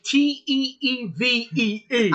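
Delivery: T E E V E E with long, stretched vowels; mock a friend by dragging out T E E V E E; hammed-up drawn-out letters in T E E V E E; exaggerated imitation. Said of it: "You say it like".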